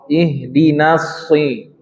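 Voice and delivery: Ihdinas shi- slowly, the shad thick; heavy shad. Shown as a demonstration. Teacher shows the thick shad to the class, slowly. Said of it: "yang tebal shad bukan na-nya".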